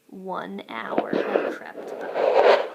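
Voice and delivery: slow, hushed whisper; creeping whisper. A narrator's reading. Narrator whispering as the wolf waits. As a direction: let the time creep by in a whisper. Suspense line.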